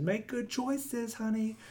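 high, slow, soft sing-song; drawn-out mock-sweet parting advice; sing-song parent send-off. Parent sending daughter off from the car, sing-song. Parent calling to departing child.

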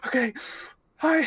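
sigh, then put on a greeting; forced composure after sigh. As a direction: gather yourself and greet the next caller. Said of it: "on the verge of crying".

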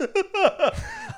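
high-pitched giggling laugh; real laugh. Real, not acted. Amused by his own comeback to the friend, high laugh.

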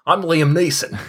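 mock Aussie introduction; attempted Australian accent. Shown as a demonstration. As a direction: joke stab at an Aussie accent. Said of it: "let me hear your best Australian accent".

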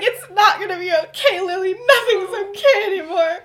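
hammed-up despairing outburst; loud, laughing mock anguish; mock explosion. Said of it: "explodes at her".

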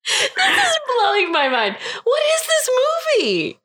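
high shrieking laugh through the words; real laughing, pitch shooting up; laughing disbelief. Real, not acted. Friend hears the film's wild ending, laughing in disbelief.

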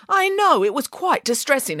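a prim pig's voice; Nanny Piggins voiced. A narrator's reading. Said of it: "agreed Nanny Piggins".

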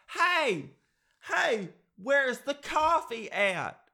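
very high, drawn-out calling out; mock obnoxious holler. Someone bursts into a library wanting coffee, mock obnoxious holler. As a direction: holler it like an oblivious loudmouth. Oblivious person yelling in a library.

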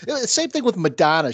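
ordinary talk

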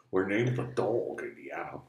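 Scottish movie-dad impression. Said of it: "you're actually quoting the movie".

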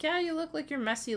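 high, fast teasing voice; mocking teaser. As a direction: rattle it off like a teasing friend. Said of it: "they're like".

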